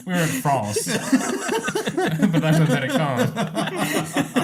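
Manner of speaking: high, drawn-out laughing repeat. Real, not acted. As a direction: giggle through the words slowly. Mocking the line while laughing.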